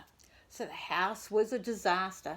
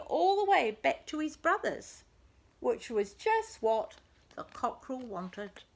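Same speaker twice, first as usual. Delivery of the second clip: ordinary delivery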